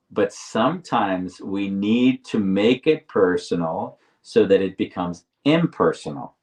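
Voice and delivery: slow, deliberate phrasing. Real, not acted. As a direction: spell it out slowly for the listener. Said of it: "I'm gonna say it slowly".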